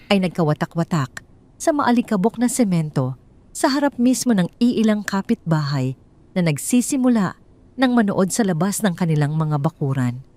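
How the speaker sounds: ordinary delivery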